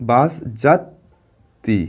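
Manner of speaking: slow, split বাস যাত্রী; slow syllables. Shown as a demonstration. Teacher sounding out বাস যাত্রী for pupils. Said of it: "তাহলে আমরা কিভাবে বলি".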